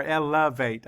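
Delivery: "elevate" broken into el-uh-vate; careful, spaced el-uh-vate for "elevate"; corrected syllables. Shown as a demonstration. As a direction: say "elevate" in syllables, middle one "uh". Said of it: "I should say".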